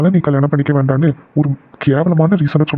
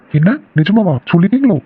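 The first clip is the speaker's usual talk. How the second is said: higher, swooping surprised question; 5 semitones higher than usual. Acted. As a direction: ask in astonishment, pitch jumping up. Learns brother has confessed his feelings.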